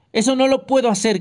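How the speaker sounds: mildly hammed-up furious yell; furious shout. A narrator's reading. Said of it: "gritó Ogoro lleno de furia".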